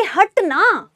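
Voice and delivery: getting impatient with someone in the way; mild annoyance. Pretend. Student told to shove the girl aside, mildly irritated. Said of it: "थोड़ी सी आपको गुस्सा आएगा ना".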